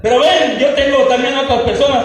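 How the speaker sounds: loud, high, commanding voice of God; voicing God. God shows the pastor who reads the Bible, loud commanding. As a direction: boom it out like God calling you over. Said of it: "si Dios me agarraba y me decía".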